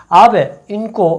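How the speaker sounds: usual speaking voice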